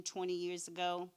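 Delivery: usual speaking voice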